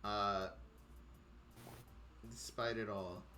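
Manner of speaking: low, soft, halting narration; hushed storyteller with long pauses; game master scene setting. A narrator's reading. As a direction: describe the city quietly, feeling your way.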